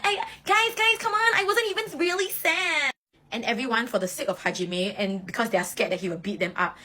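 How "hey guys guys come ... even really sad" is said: very high, slow forced nonchalance; fake-casual cover-up. Embarrassed captain telling the team he wasn't sad, very high and forced. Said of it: "Oikawa will be like".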